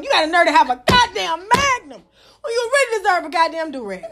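ordinary talk

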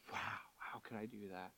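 very low, hushed self-reproach; quiet, appalled muttering to yourself; self-condemnation. Retold or imitated. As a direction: barely voice it, as if ashamed of yourself. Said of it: "with a shadow of condemnation".

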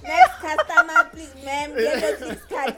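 playful shop teller calling the queue; mock cashier through laughter. Playing a store cashier.

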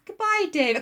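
high-pitched reluctant farewell; acted tearful mother saying goodbye; mock emotional goodbye. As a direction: let the farewell wobble upward. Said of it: "don't be so emotional".

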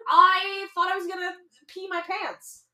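ordinary delivery

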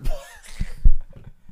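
loud laugh. Real, not acted. Laughter at cockeyed joke.

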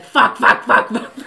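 loud, rapid-fire mimicked swearing; imitating a defiant child while breaking into laughter; laughing imitation. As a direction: blurt it loudly, about to crack up.

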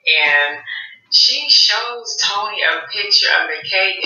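ordinary talk

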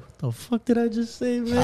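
high-pitched, softly squeaked self-astonishment; mock disbelief at himself. Host retells catching himself talking to the neighbor, mock disbelief. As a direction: play up being startled by your own words. Retold shock at own words.

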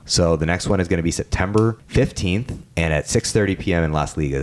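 ordinary delivery

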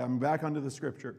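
usual speaking voice

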